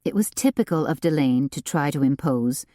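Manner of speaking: ordinary delivery, nothing marked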